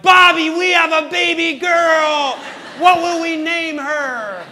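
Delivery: high, loud, drawn-out elated cry; overjoyed shout. Retold or imitated. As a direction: holler the great news, stretching every word. Retold joyful announcement of baby girl.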